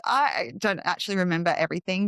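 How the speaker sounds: ordinary talk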